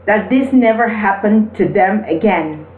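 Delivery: ordinary talk, nothing marked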